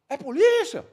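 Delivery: high, loud incredulous yell; re-enacted shouted question. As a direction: shout back a startled question. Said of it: "Aí ele".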